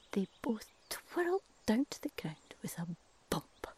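ordinary delivery

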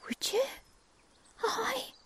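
high, hopeful little question; hopeful squeak. Acted. Cuckoo hopeful as crow offers to help. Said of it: "says Crow".

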